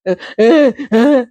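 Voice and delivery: imitated blubbering uh uh; mock sobbing. Family crying harder.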